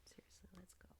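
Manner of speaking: breathy unvoiced whisper under the breath. Real, not acted. Streamer whispering to herself before starting. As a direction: whisper it so nobody hears.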